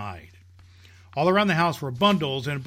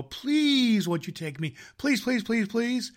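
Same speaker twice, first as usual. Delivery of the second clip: pleading; 7 semitones higher than usual. Acted. Girl begs Santa for ride.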